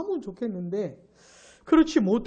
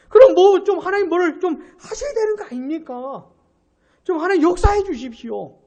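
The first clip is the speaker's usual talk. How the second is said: rising, louder, breaking into a tearful plea; pleading prayer; 6 semitones higher, 4 dB louder than usual. Retold or imitated. Prophet crying out to God, growing louder and tearful. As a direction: beg God to act, voice cracking as it climbs. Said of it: "하나님께 기도하는 거예요".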